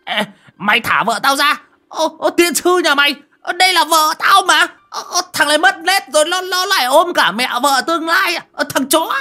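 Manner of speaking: very high, loud panicked yelling; frantic shouting. Acted. Bald old man yelling at the boy to free his wife, frantic shout. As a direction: yell to drag him off your wife. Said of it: "mà quát".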